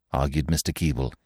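usual speaking voice

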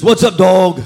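a loud, cocky street greeting; mock street slang hello; imitating friend. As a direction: throw it out like a homeboy. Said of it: "Who talks like that?".

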